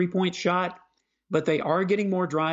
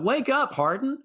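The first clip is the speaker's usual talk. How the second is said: higher-pitched mock fan heckle; retold yell; 10 semitones higher than usual. Host quoting a fan at the Lakers game, retold yell. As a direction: call it out like an exasperated fan. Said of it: "a fan actually yelled out".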